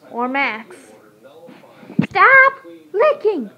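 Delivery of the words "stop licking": loud, high, slowed scold at the dog. Real, not acted. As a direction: stop the dog licking with a sharp call.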